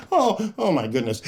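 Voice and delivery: ordinary delivery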